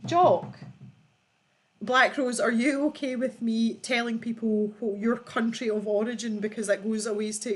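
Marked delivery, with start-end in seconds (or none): none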